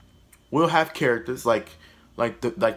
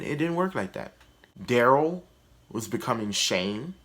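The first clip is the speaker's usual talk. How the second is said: ordinary delivery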